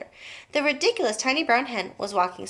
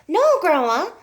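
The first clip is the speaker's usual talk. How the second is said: pleading no; 8 semitones higher, 6 dB louder than usual. A narrator's reading. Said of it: "George said".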